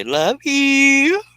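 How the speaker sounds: high loud sing-song. Pretend. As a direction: chirp it sweetly up high. Text to wife.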